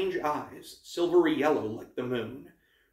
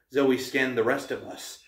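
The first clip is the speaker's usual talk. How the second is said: ordinary delivery, nothing marked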